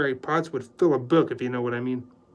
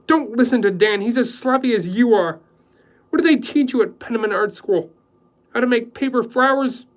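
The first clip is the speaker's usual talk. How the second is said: scornful; 10 semitones higher, 9 dB louder than usual. Acted. Joy berates the houseboy.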